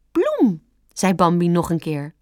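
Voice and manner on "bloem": put-on very high, childlike single word. A narrator's reading. Fawn naming the skunk once more, insisting. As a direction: repeat your word in a tiny high voice.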